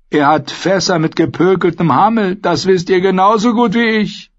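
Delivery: high, agitated accusation; heated insistence. A narrator's reading. Hungry brother accuses the host of hoarding, heated insistence. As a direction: insist on it, voice climbing.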